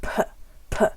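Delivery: P popped with a burst of breath; aspirated P, just air; puff of air. Shown as a demonstration. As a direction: blow out each P sound alone. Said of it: "an extra puff of air".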